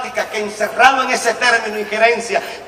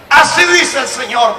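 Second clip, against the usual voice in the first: slow, louder, higher prophetic cry; anointed proclamation; 5 semitones higher, 8 dB louder, slower than usual. Retold or imitated. Preacher recalls proclaiming to the crowd, slow and loud. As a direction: proclaim it as the anointing takes over. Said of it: "la unción se apoderó de mí".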